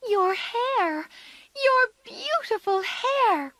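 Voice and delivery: high, loud dismayed cry. A narrator's reading. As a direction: cry out in shock at the cut hair. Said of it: "cried Amy".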